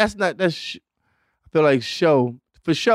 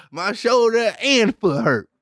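slow, higher mocking quote; mock quote again; 6 semitones higher, slower than usual. Mocking Kool-Aid's example sentence, slowly. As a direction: drawl it out like a dumb example.

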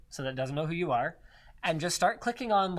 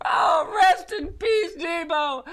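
high, loud mock-tearful voice; hammed-up tearful eulogy; crying voice; 10 semitones higher, 3 dB louder, slower than usual. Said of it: "stop the crying voice".

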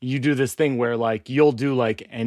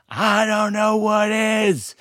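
raised, drawn-out declaration; mock-emphatic proclamation; 6 semitones higher, much slower than usual. Host clinches his mattress testimonial, mock-emphatic and high. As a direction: belt it out as the pitch's climax. Mock-triumphant climax of ad read.

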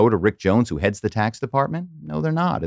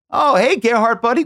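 friendly greeting; 5 dB louder than usual. Retold or imitated. Colleague greets fake Gerhard.

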